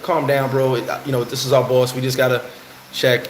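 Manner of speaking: low, even cop voice telling him to calm down; lightly voiced cop calming a flipped-out driver; rookie cop.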